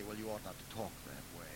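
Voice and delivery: soft, quick, prim scolding; mock the holy people's gentle reproach; prim pious rebuke. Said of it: "Holy people say".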